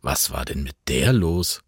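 ordinary delivery

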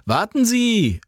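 high urgent call after her. A narrator's reading. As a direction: shout to stop her. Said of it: "rief er".

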